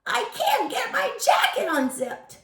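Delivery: put-on high, odd voice of a small boy; first grader asking Charlie for help; funny little kid voice. A narrator's reading. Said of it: "the first grader with the funny voice".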